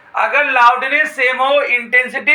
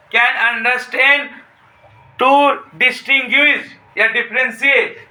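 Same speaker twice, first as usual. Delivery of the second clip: very slow dictation, word by word with long pauses; much slower than usual. Shown as a demonstration. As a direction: spell it out slowly so students can write. Teacher dictates definition slowly.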